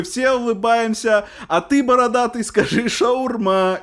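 high, loud, slow mocking call; mocking stranger. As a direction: talk down like a smug stranger. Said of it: "он такой".

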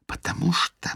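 dramatic breathy secret whisper; tragic whisper. A narrator's reading. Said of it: "трагическим шёпотом".

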